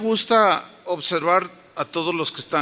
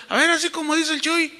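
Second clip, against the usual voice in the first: high-pitched mock defiance; defiant challenge; 10 semitones higher than usual. Imitating people who challenge God, high and defiant. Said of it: "y desafían a Dios".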